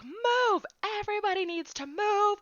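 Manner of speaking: very high, loud command to clear the crowd; retold manager shout. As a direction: bark it out over the crowd, high and sharp. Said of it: "my manager's like".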